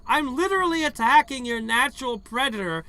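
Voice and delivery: very loud high indignant shouts; outraged yelling. Real, not acted. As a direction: yell in disbelief. Outraged at game enemies.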